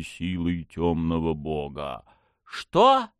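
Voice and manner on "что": high startled gasping questions; surprised cry. A narrator's reading. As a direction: blurt it out in shock. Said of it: "изумлённо вскрикнула Настя".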